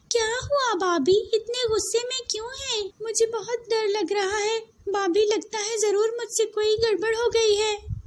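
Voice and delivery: put-on high, anxious girlish voice; a scared girl expecting punishment; frightened girl. Girl frightened by angry sister-in-law. (डरी हुई लड़की)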